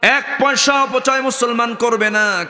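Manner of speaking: high-pitched sermon delivery; preaching. Acted. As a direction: preach it high and insistent to the congregation. Preaches in raised chant.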